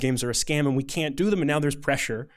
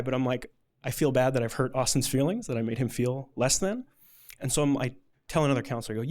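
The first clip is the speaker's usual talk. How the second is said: ordinary delivery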